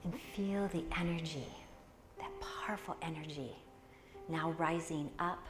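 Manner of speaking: soft, hushed meditation guide, half whispered; guided meditation. Acted. Teacher guiding the class into meditation, soft hushed voice.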